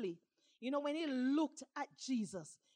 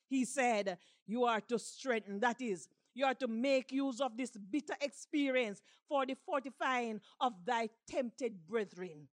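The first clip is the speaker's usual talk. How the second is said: normal talking voice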